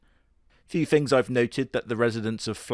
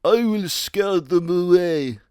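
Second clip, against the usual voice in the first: higher, slow put-on bear voice; Uncle Bear voice; 7 semitones higher, 5 dB louder, slower than usual. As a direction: boast it slowly in a silly character voice. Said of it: "is like".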